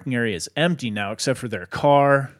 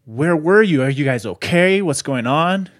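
louder, higher imitated shouting; retold yelling; 3 semitones higher, 7 dB louder than usual. Husband runs down the trail to missing family, retold yelling. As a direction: mimic an urgent yell of questions. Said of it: "yelling at him".

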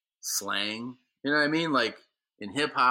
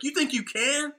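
high-pitched incredulous challenge; scornful doubt. Retold or imitated. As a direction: imitate someone scoffing at his chances. Retold guy doubts him.

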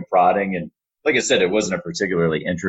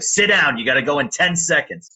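urgent, much higher Staten Island boss voice; Staten Island accent; 8 semitones higher than usual. Retold or imitated. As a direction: snap the order in her Staten Island accent, pitched up. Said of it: "in her thick Staten Island accent".

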